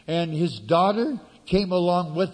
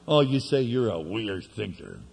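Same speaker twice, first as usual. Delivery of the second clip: normal talking voice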